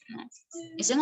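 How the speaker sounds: normal talking voice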